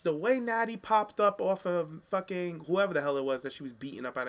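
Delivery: ordinary talk, nothing marked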